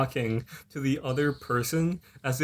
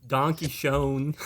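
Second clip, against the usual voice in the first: drawn-out American-accented "Donkey Schoen"; exaggerated American accent, very slow; mega American accent; much slower than usual. Shown as a demonstration. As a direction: butcher the German slowly in a heavy American accent. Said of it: "with the Omega American accent".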